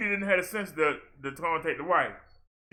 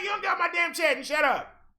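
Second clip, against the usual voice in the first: retold shout; 8 semitones higher than usual. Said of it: "I'm like".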